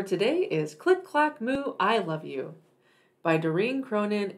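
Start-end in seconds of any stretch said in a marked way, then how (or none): none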